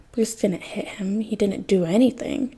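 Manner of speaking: ordinary delivery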